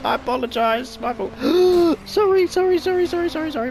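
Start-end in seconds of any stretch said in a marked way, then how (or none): 1.4-2.0 sharp gasp. Real, not acted. Crashing into cars. 2.1-3.7 flustered apologies. Real, not acted. Hitting people while driving.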